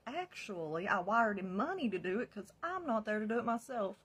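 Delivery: loud, high-pitched retort; retold heated comeback.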